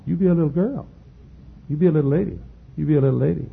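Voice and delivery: high-pitched playful coaxing; mimicking mama back. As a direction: turn mama's pep talk back on her. Teasing mama with her words.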